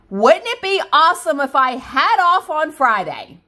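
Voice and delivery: high, loud, gleeful wishful question; excited wishing. Pretend. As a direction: exclaim it like a dream come true. Playful wish for a day off.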